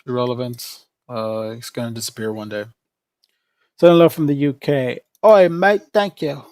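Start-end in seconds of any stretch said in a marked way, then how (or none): 5.2-6.4 a quick, hammed-up British "Oi, mate"; half-hearted British accent, a bit louder and higher; mock British accent. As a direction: try a Cockney greeting and land somewhere near Australian. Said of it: "Wait, that's more Australian".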